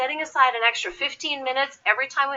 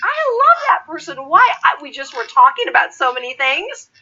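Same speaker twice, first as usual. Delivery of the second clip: much higher and louder gushing; gushing recruiter; 5 semitones higher, 7 dB louder than usual. Retold or imitated. Recruiters rave about a chatty candidate, higher and louder. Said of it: "recruiters will be like".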